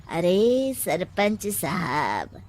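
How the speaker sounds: soft scheming laugh into the words; cunning advisor murmuring with a snigger; sly chuckle. Acted.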